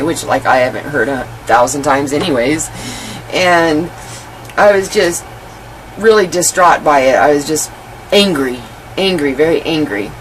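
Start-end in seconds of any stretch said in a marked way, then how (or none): none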